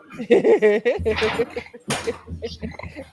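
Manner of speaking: helpless giggling fit at the clip; cracking up. Real, not acted.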